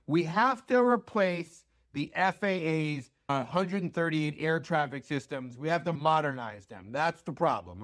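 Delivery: usual speaking voice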